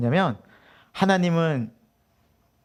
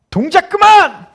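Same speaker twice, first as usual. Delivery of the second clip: loud, high military bark; shouting drill instructor; 11 semitones higher, 7 dB louder than usual. Retold or imitated. Instructor halting a bathhouse full of recruits, furious shout. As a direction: holler it out like an enraged instructor. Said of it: "소리를 빡 치면서".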